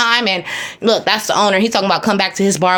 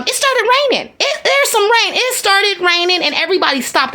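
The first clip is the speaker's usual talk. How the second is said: surprised at rain; 8 semitones higher than usual. Real, not acted. Sudden rain on the street.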